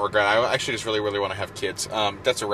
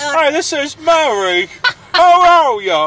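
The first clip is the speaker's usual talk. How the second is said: slow, loud, high caricature; Mary; 10 dB louder, much slower than usual. Acted. Host introducing himself as co-host, exaggerated high voice. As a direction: stretch each word high and loud, mockingly. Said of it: "doing an impression of you".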